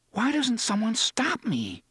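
high, frantic inner voice; panicky, racing thoughts; wild thought. A narrator's reading. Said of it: "he thought wildly".

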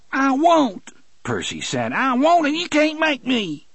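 high, petulant refusal; whiny defiant young man. Acted. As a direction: dig in like a spoiled brat. Percy refusing, high whining.

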